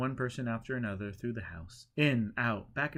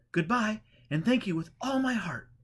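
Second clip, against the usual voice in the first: higher, louder heartfelt thanks; grateful pilgrim; 11 semitones higher, 6 dB louder than usual. A narrator's reading. Pilgrim parting from the holy man, higher and grateful. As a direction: thank him with all your heart.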